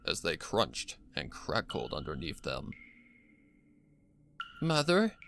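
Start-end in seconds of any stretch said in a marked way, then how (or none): 4.6-5.1 high, rising call of Mother; a child calling nervously into the dark; high anxious call. Acted.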